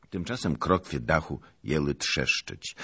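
plain reading voice

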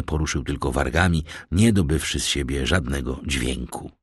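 ordinary delivery

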